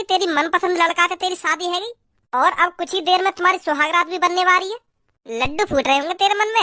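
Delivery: ordinary talk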